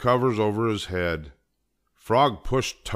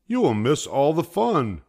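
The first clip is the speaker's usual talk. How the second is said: high-pitched eager protest; coaxing a reluctant friend, pitched high; pleading cry; 8 semitones higher, 4 dB louder than usual. A narrator's reading. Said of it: "cried Frog".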